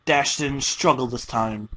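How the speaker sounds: plain reading voice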